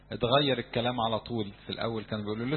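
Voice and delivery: ordinary delivery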